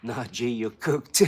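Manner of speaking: drawn-out teasing put-down; mocking slow verdict; slow taunt. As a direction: savour the insult slowly. Taunting troll.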